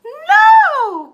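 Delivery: high, loud frightened cry; cried. A narrator's reading. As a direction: shriek no in alarm, high and loud. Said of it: "cried the witch".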